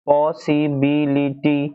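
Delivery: Possibility very slowly, syllable by syllable; five-syllable demonstration. Shown as a demonstration. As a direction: give each of the five syllables in possibility its own beat. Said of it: "पांच syllables है".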